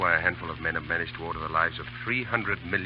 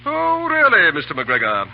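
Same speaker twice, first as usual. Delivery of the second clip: mock surprise; 9 semitones higher, 10 dB louder, slower than usual. Officer teasing his friend.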